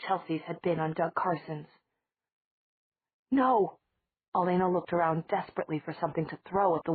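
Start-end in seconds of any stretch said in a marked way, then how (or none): 3.3-3.8 a sudden high shout of alarm; sharp cry. Acted. Elena cries out in alarm at the window, high shout. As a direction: cry out to stop the attack. Elena sees kitten about to attack.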